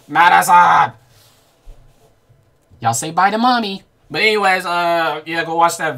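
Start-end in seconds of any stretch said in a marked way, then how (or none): 0.1-0.9 loud call across the room. Real, not acted. Streamer calling out to Madison, loud. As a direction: call her name to get her attention.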